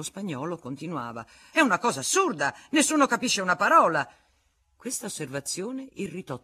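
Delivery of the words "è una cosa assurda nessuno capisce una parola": high, loud and fast complaint; exasperated protest. Acted. Federico complaining nobody understands, high, loud and fast. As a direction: complain hotly to the room.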